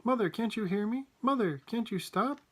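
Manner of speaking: high, distressed pleading; anguished rise in pitch; wailing plea. A narrator's reading. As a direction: beg mother to listen. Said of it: "wailed Missus Bucket".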